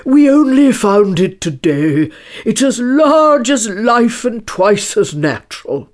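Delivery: big, emphatic eager delivery. A narrator's reading. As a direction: show off your find with great eagerness. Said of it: "Hare replied eagerly".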